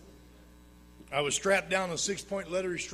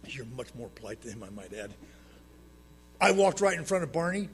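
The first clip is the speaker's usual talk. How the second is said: usual speaking voice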